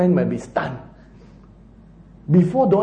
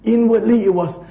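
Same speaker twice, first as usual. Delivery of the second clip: ordinary talk, nothing marked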